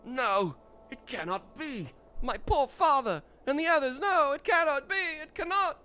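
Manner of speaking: high, anguished cry of disbelief; lightly voiced son mourning his father; grieving young hero. Voicing grieving character in quest.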